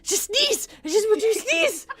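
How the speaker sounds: high, loud exasperated yelling; frustrated yelling. Pretend. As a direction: yell at the sneeze in frustration. Yelling at stuck sneeze.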